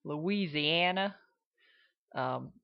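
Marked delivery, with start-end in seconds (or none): 0.0-1.1 slow Louisiana drawl; Southern Louisiana accent; generic Southern accent. Shown as a demonstration. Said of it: "generic, uh, Southern accent".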